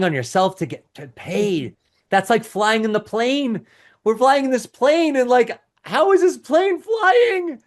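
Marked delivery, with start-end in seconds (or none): 2.1-7.6 rising higher into a slow, hammed-up astonished voice; mock bewilderment. Coach mimics a confused new business owner, mock astonished. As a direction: play it like a baffled passenger, drawing it out.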